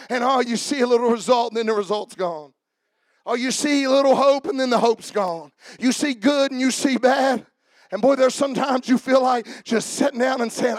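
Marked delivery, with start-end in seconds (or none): none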